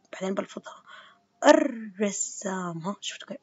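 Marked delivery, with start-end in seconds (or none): 1.5-2.8 الرسام very slowly, no l, r doubled: arrassam; teacher modelling الرسام slowly; sun lam, doubled r. Shown as a demonstration. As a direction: drop the l, lean on the double r. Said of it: "اسمعوا شو حكيت".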